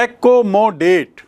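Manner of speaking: एकोमोडेट slowly split into four beats; syllable by syllable. Shown as a demonstration. Teacher counting for the class, एकोमोडेट in separate syllables. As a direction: sound out एकोमोडेट one syllable at a time. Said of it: "चार बार आ रहा है".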